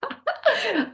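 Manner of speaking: short, high-pitched laugh; exasperated laugh. Real, not acted. Homeowner hearing the beep on return, exasperated laugh. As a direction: laugh helplessly at the absurdity.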